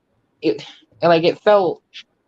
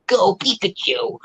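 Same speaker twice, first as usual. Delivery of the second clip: higher-pitched, drawn-out battle cry; terrible Ash impression; 6 semitones higher, slower than usual. Retelling cartoon blaring in a sleeping house, mock battle cry. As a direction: slowly call out an attack command like a cartoon trainer. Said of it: "that was a terrible Ash impression".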